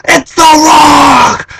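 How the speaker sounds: high, drawn-out reenacted shriek; flipping out. Fan explaining to girlfriend who came back, flipping-out scream. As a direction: stretch the name into a fan scream. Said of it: "And I'm flipping out".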